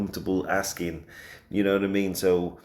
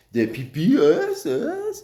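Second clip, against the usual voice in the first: high, slow, squeaky drawn-out letters; a silly high-pitched aside; a squeaky, slow, goofy voice; squeaky mock voice; 11 semitones higher, 5 dB louder, much slower than usual.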